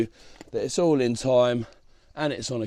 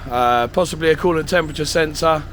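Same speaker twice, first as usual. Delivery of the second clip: louder, talking over engine noise; raised voice; 2 semitones higher, 5 dB louder than usual. Real, not acted.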